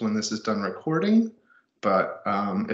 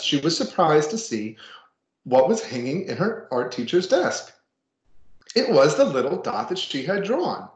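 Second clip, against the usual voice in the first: put-on reading to children; 5 semitones higher than usual. Reads picture book to class.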